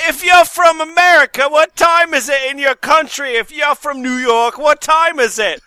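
a wobbly fake London accent asking about time; West London accent. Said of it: "What accent was that?".

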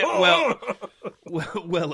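breaking into laughter; laughing while reading. Real, not acted. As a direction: giggle through the words. Laughing over the text.

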